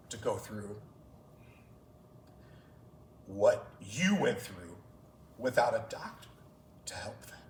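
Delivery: choked up, halting and fading; real emotional. Real, not acted. Coach retelling his athlete's reason for choosing medicine, choked up. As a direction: fight back emotion, with long pauses between phrases.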